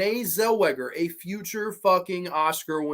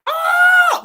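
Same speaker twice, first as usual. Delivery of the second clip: short shrill scream, much higher and louder; mock scream; 10 dB louder than usual. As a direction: let out a sudden horror-movie shriek. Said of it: "and screams".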